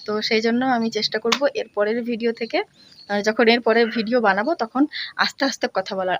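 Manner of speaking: ordinary talk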